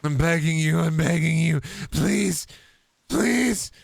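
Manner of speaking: high whiny begging; pathetic begging. Pretend. Customer begging Arby's staff, high whiny plea. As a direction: plead pitifully.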